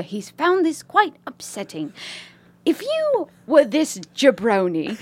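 put-on British lady accent. Reading a listener's email as a British lady, British accent. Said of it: "British lady writing this email".

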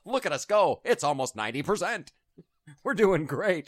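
loud, high sarcastic cheerleading; mock boasting. Spokesman bragging about Obamacare numbers, mock-triumphant. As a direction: crow over a hollow triumph.

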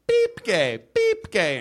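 mock checkout scanner voice; robotic beeping cashier.

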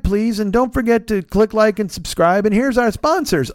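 drawn-out, put-on podcast intro voice; mock podcast host. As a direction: parody an amateur host's opening. Mimics friends' generic podcast intro.

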